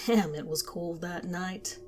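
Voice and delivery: plain reading voice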